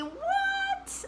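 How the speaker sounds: very high, astonished what; high surprised what; amazed. Acted. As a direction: squeak it in surprise. Amazed exclamation.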